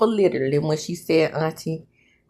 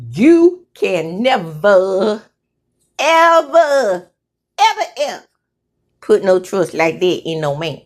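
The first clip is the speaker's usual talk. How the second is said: emphatic, disillusioned wife; 5 semitones higher, 4 dB louder than usual. Acted. Woman warning against trusting men, emphatic. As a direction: stretch and hammer each "ever". Acts wife's bitter warning.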